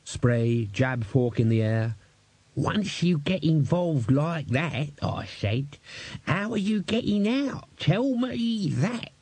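put-on high, slow, querulous old codger; old man muttering at his fry-up; cranky old man. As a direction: grumble like a fussy old servant. Old man Albert raving.

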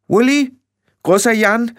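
alarmed high-pitched calling out; loud shrieked summons; Ginny. A narrator's reading. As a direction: call urgently for help, very high. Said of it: "rief sie".

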